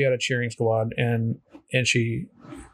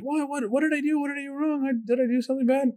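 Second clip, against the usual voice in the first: much higher, fast, anxious questions; high, fast, worried girl; worried confusion; 13 semitones higher, faster than usual. Retold or imitated. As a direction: rush out nervous, confused questions. Confused swimmer voiced in retelling.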